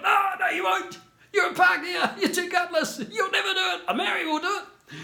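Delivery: high, loud laughing jeers; mocking group shout. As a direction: shout them down, laughing as you mock. Mates taunting Stewie, shouting.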